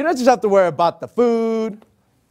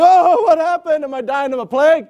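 mock panic; 6 semitones higher, 4 dB louder than usual. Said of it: "He's like".

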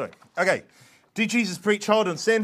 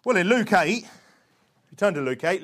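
ordinary delivery

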